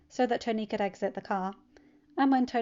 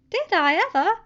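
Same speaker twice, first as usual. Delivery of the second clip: put-on high, louder, bright-eyed teen voice; cheerful teen; 12 semitones higher, 11 dB louder than usual. Teen telling his uncle about his weekend, cheerful and high. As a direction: bounce through it like an eager kid.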